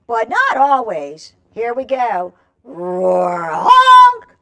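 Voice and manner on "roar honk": silly goose trying to roar; roar honk. Shown as a demonstration. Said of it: "Everybody do it again".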